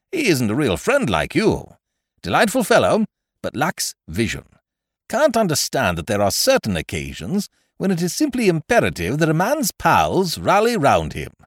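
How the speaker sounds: lightly voiced chum, high and emphatic; hearty persuader. Ukridge flatters his visitor about loyal pals, hearty. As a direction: make a lofty appeal to friendship.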